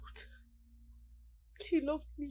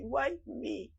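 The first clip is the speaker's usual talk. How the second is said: usual speaking voice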